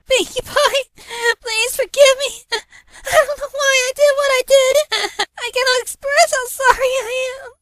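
high, loud, slow pleading through sobs; tearful lament. A narrator's reading. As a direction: wail the apology while crying. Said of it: "she lamented".

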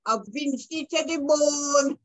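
Vincentian dialect. Retold or imitated. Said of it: "in Vincentian dialect, we like to say".

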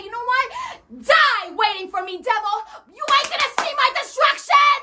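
rising defiant challenge to the devil; defiant shout. Acted. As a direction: throw it in the devil's face. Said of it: "you say".

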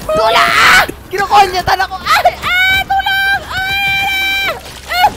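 very high shrieked cries; real high, loud game panic; panicked screaming. Real, not acted. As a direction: scream as if under attack. Player being attacked in game.

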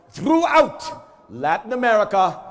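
loud, slow ring intro, rolling the name; lucha libre announcer. Acted. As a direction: call him in like a wrestling star. Introduces the Spanish voice.

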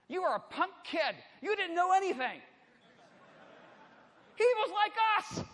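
high, loud, rising outburst; impassioned preaching shout. Real, not acted. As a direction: build to a full-voiced shout. Preacher builds to a shouted climax.

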